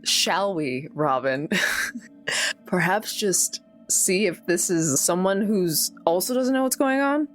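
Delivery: ordinary talk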